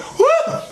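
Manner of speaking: very high-pitched comic whoop; imitated yelp of shock; startled clench noise. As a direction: let out a sudden high "whoa". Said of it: "I made a noise".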